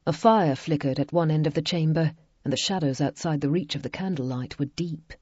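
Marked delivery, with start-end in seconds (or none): none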